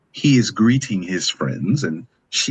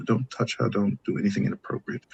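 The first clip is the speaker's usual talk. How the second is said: normal talking voice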